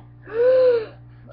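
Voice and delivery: sharp high gasp for air; imitated gasp. Imitating the goat's first breath out of the fence, sharp gasp. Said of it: "she just went".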